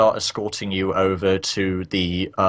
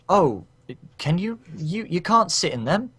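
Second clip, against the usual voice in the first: higher, lightly voiced noblewoman asking with concern; a polite worried question, pitched up; lady NPC voice; 10 semitones higher than usual.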